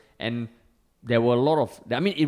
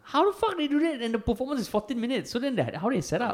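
amazed; 12 semitones higher than usual. Real, not acted. Amazed at halftime staging.

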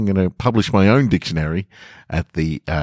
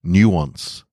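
plain reading voice

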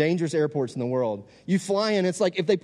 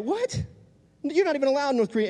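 very high, fast incredulous outburst; acted disbelief; 10 semitones higher than usual. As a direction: shoot the pitch up in mock astonishment. Friend's acted disbelief at the news.

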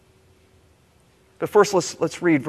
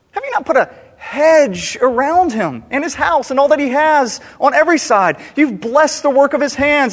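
Satan's challenge; 7 semitones higher, 5 dB louder than usual. A narrator's reading. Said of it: "Then Satan answered the Lord and said".

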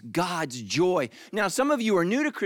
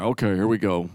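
much lower, grudging mumble; reluctant pray-er; 11 semitones lower than usual. Retold or imitated. Reluctant guest saying Thanksgiving grace, low grudging mumble. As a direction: drag yourself through the prayer. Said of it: "You're like".

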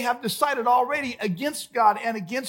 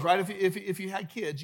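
ordinary talk, nothing marked

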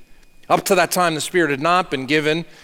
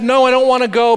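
higher, louder whiny no; mock reluctance; 6 semitones higher, 5 dB louder, faster than usual. Pastor mimics people refusing wedding invitations, mock whining. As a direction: whine like a reluctant guest.

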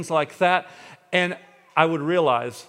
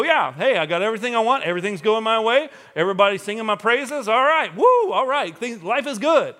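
higher, louder, hammed-up whooping swagger; cocky celebration; 7 semitones higher, 6 dB louder than usual. Imitating a carefree person bragging that life is good, mock cheer. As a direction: play the smug winner shouting woo.

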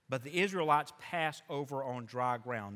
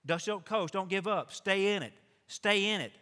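building louder and higher to the last lines; rally the staff, pushing harder each time; exhorting challenge; 4 semitones higher, 4 dB louder than usual. Retold or imitated. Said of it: "Our pastor challenges the staff".

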